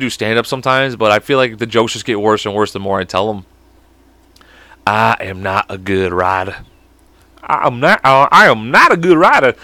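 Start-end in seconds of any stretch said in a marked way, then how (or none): 4.9-6.6 low, slow put-on drawl; character voice. Shown as a demonstration. Trying out a character voice, low and slow. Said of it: "that one I just did". 7.4-9.5 character voice. Shown as a demonstration. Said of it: "I'm gonna do this voice".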